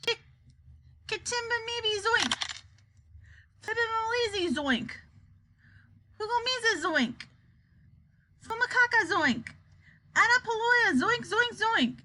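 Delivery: high, strange incantation; wild, fearsome yell. A narrator's reading. Wonka yelling mystic words at the world, wild high voice. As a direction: call each mystic phrase out high and wild. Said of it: "and in a wild and fearsome voice, he yelled".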